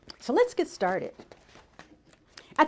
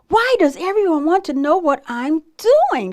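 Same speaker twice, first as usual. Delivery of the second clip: loud, higher grumpy outburst; exasperated shout; 5 semitones higher, 9 dB louder than usual. A narrator's reading. Bird fed up with everyone asking, loud exasperated shout. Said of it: "shouted Bird".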